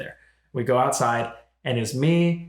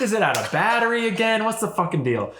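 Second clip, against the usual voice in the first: higher, exasperated complaint retold; 9 semitones higher than usual. Gamer griping about the alarm, exasperated. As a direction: gripe at the alarm going off again. Annoyed reaction to alarm going off.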